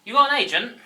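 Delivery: high, loud disbelieving question; surprised. Acted. Friend asking Adam about his agent, loud high disbelief.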